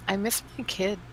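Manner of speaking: talking quietly. Acted. Character talking to him on waking up, quietly. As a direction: say it softly, as if confiding. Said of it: "talking quietly to".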